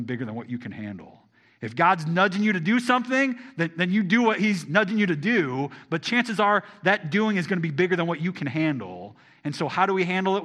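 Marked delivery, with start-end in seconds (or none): 1.6-10.4 preaching build. Acted.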